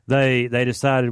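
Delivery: ordinary talk, nothing marked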